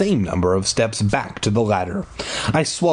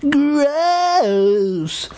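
scream. A narrator's reading. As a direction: shriek it in disgust. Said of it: "I screamed".

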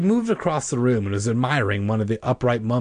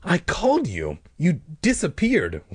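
high, indignant accusation; pitched-up, aggrieved protest; angry demand; 8 semitones higher than usual. A narrator's reading. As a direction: accuse her with mild acted anger. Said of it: "I demanded angrily".

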